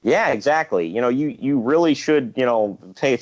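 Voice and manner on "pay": usual speaking voice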